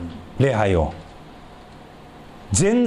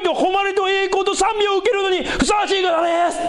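much higher, louder proclamation, like angels shouting; loud praise. Acted. Preacher acting out the angels' great praise, a loud high shout. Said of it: "彼らは大声で言った".

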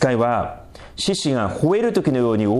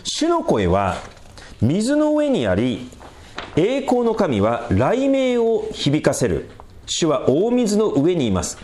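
slow, raised reading of the psalm; solemn psalm recital, higher and slower; scripture reading; 6 semitones higher, slower than usual. Real, not acted. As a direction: declaim the verses with weight. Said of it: "ちょっと読んでみますね。".

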